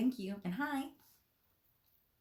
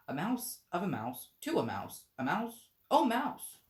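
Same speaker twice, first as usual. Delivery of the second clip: rising, louder chant ending in a high call; reciting a declension; 6 semitones higher, 7 dB louder than usual. Acted. Alice reciting her brother's Latin grammar, building to a loud high call. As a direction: drill it like a grammar lesson.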